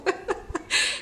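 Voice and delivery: unvoiced laugh through tears; a soft tearful laugh; breathy teary laugh. Real, not acted. Vlogger tearing up on camera, teary laugh. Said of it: "I get very emotional".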